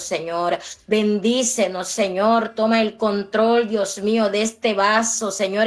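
ordinary talk, nothing marked

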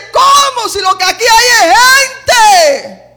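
high, very loud cry of shock; acted panicked shouting; alarmed yell. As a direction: yell it out in disbelief. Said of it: "pega un grito y dice".

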